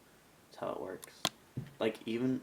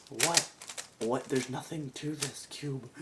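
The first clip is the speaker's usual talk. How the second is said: tag; 5 semitones higher, slower than usual. Acted. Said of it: "you'll be like".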